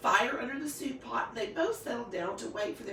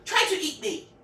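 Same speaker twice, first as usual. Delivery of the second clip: demanding shout; 7 semitones higher, 7 dB louder than usual. A narrator's reading. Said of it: "snored the wolf".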